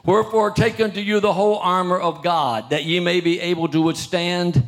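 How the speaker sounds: loud, measured Bible reading; preacher reading the King James aloud; scripture reading. Acted. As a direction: proclaim the verse to the congregation.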